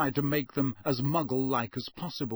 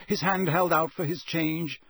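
ordinary delivery, nothing marked

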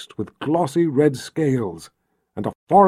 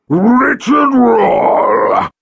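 high, loud, slow dragon cry; joyful dragon shout; 9 semitones higher, 6 dB louder, much slower than usual. Acted. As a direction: roar his name in delight. Dragon recognizes Richard.

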